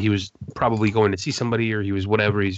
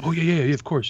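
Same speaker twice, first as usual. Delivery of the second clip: imitating friendly artist; 7 semitones higher than usual. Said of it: "He's like".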